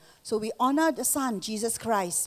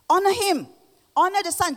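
high, loud proclamation; proclaiming; 9 semitones higher, 6 dB louder than usual. Acted. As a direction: call it out from the pulpit. Quoting Jesus in sermon.

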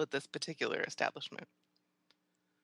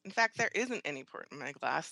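ordinary delivery

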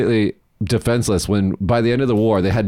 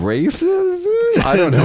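very high, drawn-out squeal; squeaky question; 3 dB louder, much slower than usual. Real, not acted. Storyteller unsure what to call his story, squeaky. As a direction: stretch it upward into a squeaky, hesitant question. Joking squeaky guess at a word.

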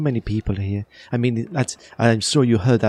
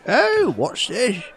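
put-on much higher, slow old-timer voice; high slow creaky old-timer asking about the cart; grumpy old locals; 5 dB louder, slower than usual. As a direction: play a fussy old villager. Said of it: "old people that are going".

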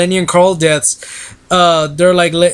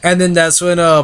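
ordinary talk

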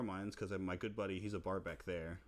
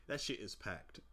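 normal talking voice

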